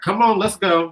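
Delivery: loud, high challenge; pushy urging. Acted. Speaker imitates Scorpio energy, pushy.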